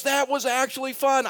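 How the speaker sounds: high-pitched imitation of a pleased visitor; delighted surprise. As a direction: retell it with amazed delight, well up in pitch.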